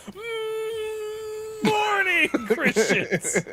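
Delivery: high squeaky preacher voice, laughing through; a hammed-up pastor greeting the church; mock preacher greeting. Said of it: "go to church and go".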